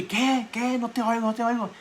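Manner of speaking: high, loud, puzzled asking again; deaf old man. Retold or imitated. Deaf old pastor failing to hear, loud mock confusion. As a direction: play the confused deaf listener straining.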